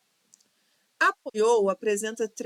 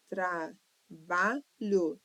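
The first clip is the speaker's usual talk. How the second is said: trabalho sílaba por sílaba; 2 semitones lower, much slower than usual. Shown as a demonstration. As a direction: split trabalho into three slow syllables. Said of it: "três sílabas". (trabalho silabado, devagar)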